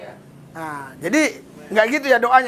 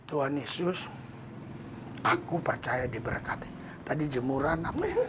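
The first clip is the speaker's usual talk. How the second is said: much softer, lower, drifting prayer; slow quiet prayer; 7 semitones lower, 14 dB quieter than usual. Shown as a demonstration. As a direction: mumble it half-distracted. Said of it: "walaupun sama kata-katanya". (doa pelan, jauh lebih lembut dan rendah)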